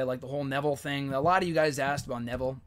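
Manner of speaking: ordinary talk, nothing marked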